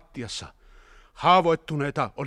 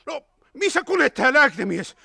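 raised, loud urgent call; shouting; 11 semitones higher, 7 dB louder, faster than usual. A narrator's reading. Hietanen calling for the driver and medic, shouting. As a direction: shout out the question. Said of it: "huusi Hietanen".

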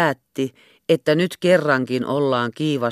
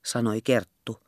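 ordinary delivery

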